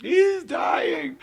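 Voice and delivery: high-pitched echo of a frantic shout; mock panicked cry. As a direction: parrot the parent's alarm for laughs. Listener mimics the retold shout.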